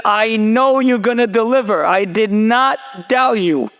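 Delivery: high, emphatic declaration of trust; acted certainty, slowing down for emphasis; insistent assurance. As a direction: imitate a daughter firmly insisting. Daughter firmly insists she trusted him.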